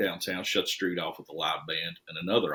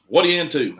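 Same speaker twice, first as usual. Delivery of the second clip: put-on higher and louder, hearty question to a kid; friendly call; 7 semitones higher, 10 dB louder than usual. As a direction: boom it out warmly to the teenager. Boyd greeting the boy.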